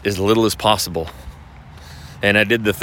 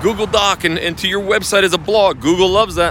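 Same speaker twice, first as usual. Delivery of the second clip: mocking the Clubhouse guy; 7 semitones higher than usual. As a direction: ham up the smug advice. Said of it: "And he's like".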